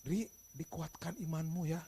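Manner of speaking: consoling. Retold or imitated. Consoling aside after boss's shout.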